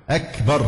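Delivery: أكبر with a crisp voiceless k; whispered-release kaf. Shown as a demonstration. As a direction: let a puff of air follow the k in أكبر. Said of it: "في همس، الكاف فيها همس". (همس الكاف في «أكبر»)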